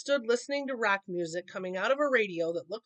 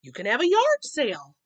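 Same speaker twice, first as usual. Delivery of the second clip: louder, eager little cub; eager suggestion; 3 semitones higher, 8 dB louder than usual. A narrator's reading. Brother eagerly suggesting a yard sale to Miss McGriz. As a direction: pitch the idea eagerly.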